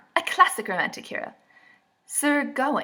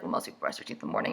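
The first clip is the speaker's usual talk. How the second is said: quiet; 9 dB quieter, much faster than usual. Retold or imitated. Voicing the reply, dry aside.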